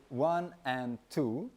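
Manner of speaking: "one, and two" counted slowly with the "and" added; half-speed count. Shown as a demonstration. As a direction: stretch it out, slow and even. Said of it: "for example".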